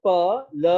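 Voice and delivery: प then ल, each said alone and slowly; slow separate प and ल sounds; sounds split out. Shown as a demonstration. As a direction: break it into प and ल, slow. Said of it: "प और ल".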